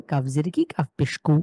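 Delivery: normal talking voice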